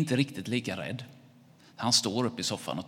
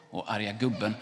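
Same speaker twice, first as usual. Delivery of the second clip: ordinary delivery, nothing marked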